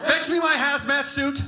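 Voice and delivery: high, hammed-up noble maid voice; mock devoted maid. Imagined maid vowing to sanitize the room, hammed-up high. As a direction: play a maid vowing heroic duty. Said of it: "was like".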